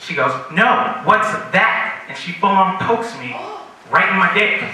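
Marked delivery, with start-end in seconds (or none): 0.5-1.9 slow insisting. Retold or imitated. Girl insisting again.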